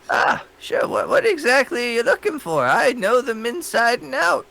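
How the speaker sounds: put-on high, eager old librarian; proud old woman who knows everything; old lady voice. Librarian voiced by game master.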